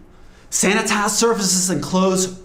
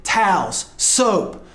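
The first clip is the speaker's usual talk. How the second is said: ordinary talk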